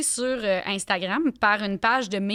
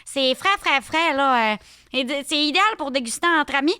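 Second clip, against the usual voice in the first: put-on Saguenay accent sommelier; 5 semitones higher, 3 dB louder than usual. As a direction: stretch out the Saguenay vowels. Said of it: "puis elle est comme".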